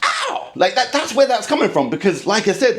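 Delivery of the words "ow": very high, comic "ow"; mock pained yelp. Back pain hitting after the backhand, mock pained yelp. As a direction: yelp as if your back just went. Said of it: "the pain comes out of nowhere".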